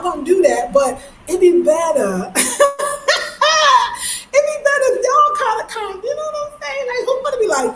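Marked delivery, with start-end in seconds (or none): 2.3-4.3 long high-pitched laugh; high cackle. Real, not acted. Cracks up at own boasting. 4.3-7.7 very high, squeaky laughing speech. Real, not acted. Laughing through her request.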